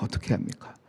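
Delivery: whispered, helpless-sounding echo; hushed plea. Acted. Believer asking God what to do, in a hushed plea. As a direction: drop the repeated question to a whisper.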